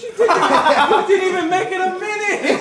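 high, laughing mockery; laughing tease. Real, not acted. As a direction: tease him while cracking up. Mocking loser, laughing.

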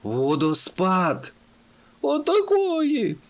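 slow cry leaping very high; shocked exclamation. A narrator's reading. Travellers gasping as they spot the waterfall, shocked. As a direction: stretch the words in mounting alarm. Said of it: "збентежено поглянули вперед і охнули".

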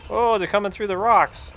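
high, loud and slow alarm. Real, not acted. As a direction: cry out in alarm. Ants swarming toward him.

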